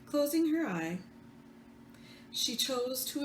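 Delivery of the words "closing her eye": ordinary delivery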